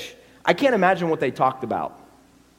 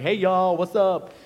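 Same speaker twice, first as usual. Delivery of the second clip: casual Jesus; 4 semitones higher, 3 dB louder, slower than usual. Pretend. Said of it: "he didn't really talk like that".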